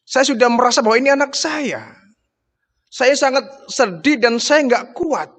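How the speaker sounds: tearful pleading. Retold or imitated. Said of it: "Sambil menangis".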